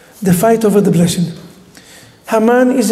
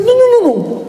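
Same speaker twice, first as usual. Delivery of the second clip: urgent cry of no; 12 semitones higher than usual. Retold or imitated. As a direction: cry it out to stop the tear. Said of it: "Yitzchak said".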